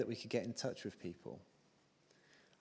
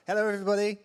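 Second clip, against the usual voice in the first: very loud, high-pitched crowd greeting; rousing shout; 10 semitones higher, 13 dB louder, much faster than usual. Real, not acted. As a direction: belt it out to get the audience shouting back. Repeats greeting for livelier response.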